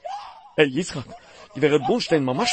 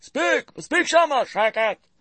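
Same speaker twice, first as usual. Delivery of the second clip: high hushing; 11 semitones higher, slower than usual. Acted.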